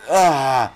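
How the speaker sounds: sharp loud cry of surprise; startled yell. Real, not acted. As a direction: yelp as you get hit. Sudden death in game.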